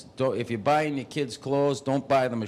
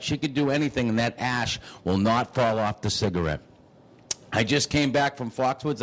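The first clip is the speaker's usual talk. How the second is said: usual speaking voice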